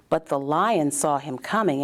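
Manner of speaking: ordinary delivery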